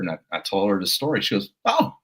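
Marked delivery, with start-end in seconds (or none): 1.6-1.9 a lightly British lady, much higher; cheery British partner greeting a colleague; mock British accent. British partner answering her colleague, breezy mock British. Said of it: "and she's British".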